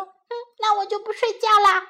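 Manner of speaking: put-on high, loud fast cheeky child; child defiant. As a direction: tease mum like a cheeky kid.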